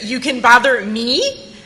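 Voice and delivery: slow, loud, high disbelief; incredulous. Retold or imitated. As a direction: let the disbelief stretch every word. Incredulous retort to coworker.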